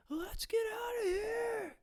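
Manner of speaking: high, slow shrieking shout; mock panicked yell. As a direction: scream at everyone to run. Movie character calls to flee.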